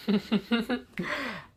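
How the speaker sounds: high, soft villainous chuckle; gloating chuckle. Acted. Gojo plots to burn the book, gloating chuckle. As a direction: chuckle softly in a high voice, relishing the threat.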